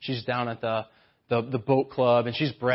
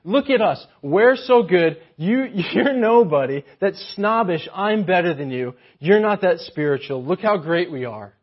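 lightly voiced snob, louder and gloating; snobbish boast; 4 semitones higher, 6 dB louder than usual. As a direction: preen like a snob. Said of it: "the mentality that these guys have".

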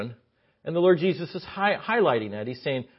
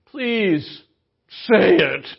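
high, loud, condescending please say it; arrogant; 6 semitones higher, 8 dB louder, much slower than usual. Acted. Pharisee answering Jesus, arrogant and condescending. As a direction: drawl it out like a smug host. He just had this arrogant attitude.

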